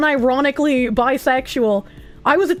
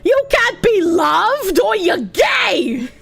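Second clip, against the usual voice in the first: mocking shout; 5 semitones higher, slower than usual.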